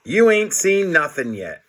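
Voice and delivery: usual speaking voice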